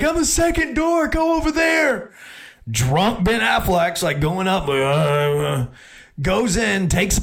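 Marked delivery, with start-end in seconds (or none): 0.0-2.1 much higher mock girl voice; imitating the girl. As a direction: toss it off in a high girly voice. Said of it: "she's like".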